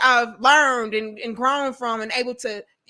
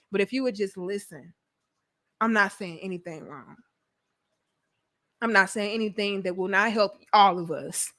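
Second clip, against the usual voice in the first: quiet; 5 semitones lower, 5 dB quieter than usual. Real, not acted. Softer plea after frustration.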